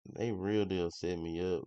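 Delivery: ordinary delivery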